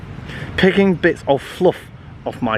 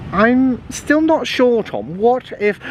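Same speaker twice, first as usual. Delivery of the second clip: doubtful mum; 9 semitones higher, slower than usual. A narrator's reading. Mum doubting the pet request.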